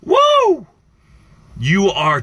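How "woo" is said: loud high laughing whoop. Real, not acted. Cheering the host with a loud high laughing woo. Joyful outburst on live show.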